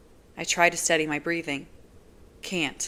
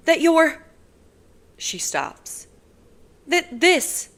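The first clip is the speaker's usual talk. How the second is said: faltering; 12 semitones higher, 7 dB louder, slower than usual. Acted. Nazira faltering.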